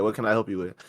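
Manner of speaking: soft, even, unbothered clerk voice; calm service voice. Shown as a demonstration. Clerk repeats his calm greeting to a rude shopper, soft and even. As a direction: stay calm and polite, no bite.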